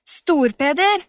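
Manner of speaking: a loud call across the distance. A narrator's reading. Camilla calls Storpeder back, loud call. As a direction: shout his name after him. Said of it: "ropte Camilla".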